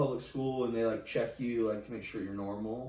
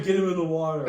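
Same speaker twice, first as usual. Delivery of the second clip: high, loud urgent call; shouting crowd; 6 semitones higher, 8 dB louder than usual. Retold or imitated. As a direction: yell it like a panicked bystander. Crowd urging rescue.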